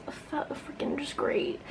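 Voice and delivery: unvoiced sarcastic whisper. Real, not acted. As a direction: whisper it in frustration. Frustrated mutter at mistake.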